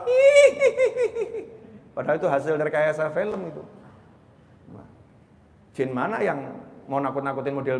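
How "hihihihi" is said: very high, drawn-out ghostly giggle; ghost cackle. Retold or imitated. Spooky sound heard passing a graveyard, mock ghost cackle. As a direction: imitate a film ghost's shrill "hihihi". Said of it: "Lewat makam ada suara".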